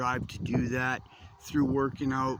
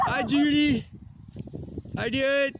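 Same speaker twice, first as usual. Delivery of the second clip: much higher and louder dog greeting; 12 semitones higher, 4 dB louder, slower than usual. Real, not acted. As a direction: squeal hello to the pup.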